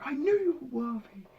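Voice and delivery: high mock Thor line; quoting Thor. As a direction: lightly voice the hero line. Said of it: "Thor was like".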